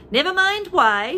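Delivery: high, loud bossy shout. A narrator's reading. Mugglewump shouting at the monkeys to obey. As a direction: snap it at the animals. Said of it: "shouted Mugglewump".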